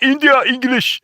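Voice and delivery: high-pitched mock Indian accent. Mocking a call-center worker's English, high exaggerated Indian accent. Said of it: "But it's like".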